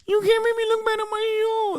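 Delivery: high, weepy mocking whine; mock crying crybaby host; whiny crybaby. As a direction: whimper it like a hurt child. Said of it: "what I hear is".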